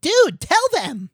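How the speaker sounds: high, drawn-out cartoon kid cry. A narrator's reading. Kyle urging his friend to confess, crying out high. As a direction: urge it in a high, slow kid's voice. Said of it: "Kyle cried".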